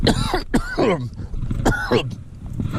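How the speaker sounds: long harsh coughing fit; choking cough. Real, not acted. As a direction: cough as if food went down wrong. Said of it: "Choking on pepperoni stick".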